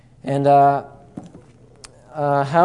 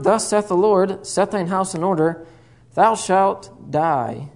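solemn scripture read, slowing on the last words; 3 semitones higher than usual. Acted. Preacher reads the prophet's message to Hezekiah, solemn. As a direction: read it like the word of the Lord. Reads Bible passage aloud.

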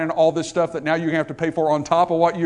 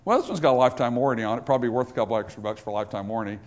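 low, quick reasoning under the breath; thinking to oneself; 7 semitones lower, 4 dB quieter, much faster than usual. Acted. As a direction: talk yourself into it, fast and low. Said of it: "you think to yourself".

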